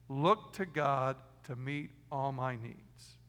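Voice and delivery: each word spaced out slowly for notes; slow dictation. Shown as a demonstration. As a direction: dictate it slowly so they can write. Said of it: "Write that down".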